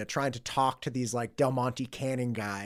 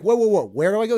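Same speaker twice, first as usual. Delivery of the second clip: loud, high mock urgency; eager urgency; 8 semitones higher, 12 dB louder, faster than usual. Retold coworker begging for directions, eager urgency. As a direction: imitate a buddy frantic to be shown. Second worker eagerly asks way.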